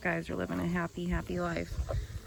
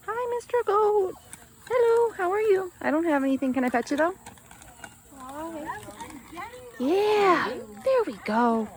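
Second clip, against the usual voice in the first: much higher and louder cooing at the goat; 11 semitones higher, 9 dB louder than usual. Real, not acted. Baby talk to a goat.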